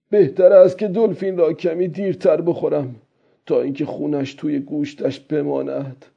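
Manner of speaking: put-on high old-man voice, thinking it over; old fisherman. Old man deciding when to eat the dolphin, character voice.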